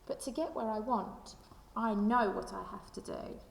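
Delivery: ordinary talk, nothing marked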